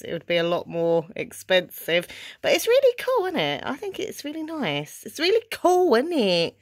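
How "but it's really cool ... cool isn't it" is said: "really cool" in a strong Essex accent. Real, not acted. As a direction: gush it in broad Essex. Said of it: "I sound really Essex".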